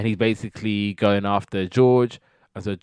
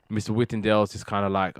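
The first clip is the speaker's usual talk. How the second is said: ordinary talk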